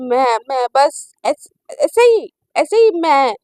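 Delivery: loud, halting, jumpy excuse; flustered stammer. A narrator's reading. Shivani cornered by Naina's question, flustered and stammering. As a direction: grope for an excuse, tripping over it.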